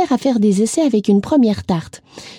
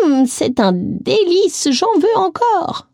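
aigu et lent, gourmande; exclamation ravie; 8 semitones higher, slower than usual. A narrator's reading. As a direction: savoure le délice. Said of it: "s'exclama-t-elle à nouveau". (la vieille dame en redemande, aiguë et lente)

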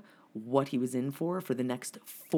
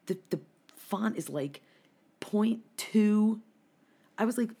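normal talking voice

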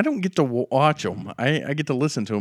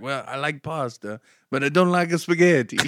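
mock Italian, rising in pitch; Italian accent; 2 semitones higher than usual. Italian coworker explaining his tastes, Italian accent. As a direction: imitate an Italian accent, building to the complaint. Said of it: "There literally is an Italian".

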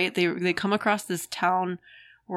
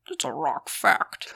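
slow rock fact voice; slower than usual. Retold or imitated. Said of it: "He's like".

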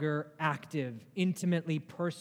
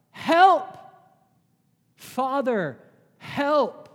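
loud, high plea for help; acted cry for help, each word on its own; 8 semitones higher, 9 dB louder, much slower than usual. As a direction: call out hard to a father for rescue. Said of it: "We were made to cry out".

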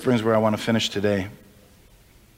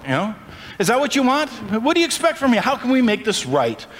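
Israelites asking God; 7 semitones higher than usual. Acted. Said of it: "they're asking you".

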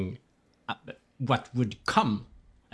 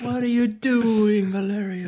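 mock Italian accent; 9 semitones higher than usual. Mock Mario-style Italian.

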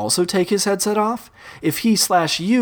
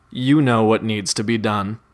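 ordinary talk

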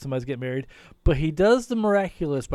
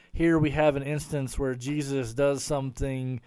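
normal talking voice

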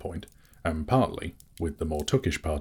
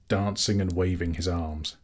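plain reading voice

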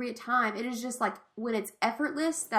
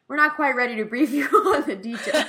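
louder quote breaking into laughter; laughing through it; 3 semitones higher, 6 dB louder than usual. Real, not acted. Retelling a favorite comic exchange to co-host, laughing. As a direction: crack up while delivering the line.

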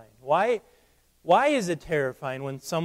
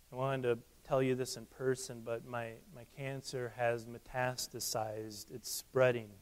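much softer, a friend breaking hard news; quiet bad news; 4 semitones lower, 10 dB quieter than usual. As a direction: let it sink to a hush. Friend reveals cancer over coffee.

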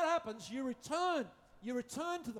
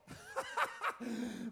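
high burst of laughter; 14 semitones higher than usual. Real, not acted. Preacher cracking up at his own joke, high laughter.

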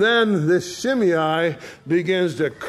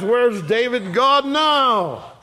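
mocking crowd; 6 semitones higher, slower than usual. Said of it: "they all ask".

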